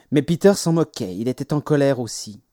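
ordinary delivery, nothing marked

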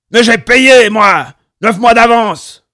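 high, loud heated demand; angry arguing. A narrator's reading. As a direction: argue hard at the top of your voice. Quarrel over rent.

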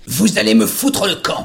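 roaring. A narrator's reading. Doctor roaring at the detective to get out. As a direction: roar him out of the room. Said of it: "rugit-il".

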